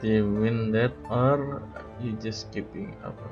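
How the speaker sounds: ordinary talk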